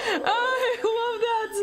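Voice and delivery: slow, high-pitched gushing; delighted cooing. Real, not acted. Streamer adoring the scared little raptor, high delighted coo. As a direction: draw it out in a high, adoring squeal.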